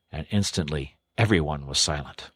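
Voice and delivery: ordinary delivery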